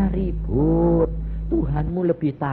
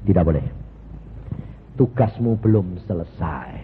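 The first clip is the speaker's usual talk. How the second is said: voice of Jesus; 7 semitones lower than usual. Retold or imitated. Jesus answers the preacher.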